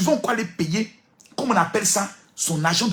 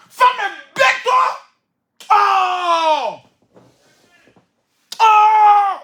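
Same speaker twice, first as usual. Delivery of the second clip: despairing shouts; 8 dB louder, much slower than usual. Real, not acted. Preacher crying out at women.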